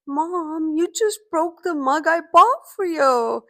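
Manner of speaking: put-on high, slow, shaky child's voice; a heartbroken kid barely holding back tears; high, slow teary child pleading; shaking little voice. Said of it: "with her shaking little voice".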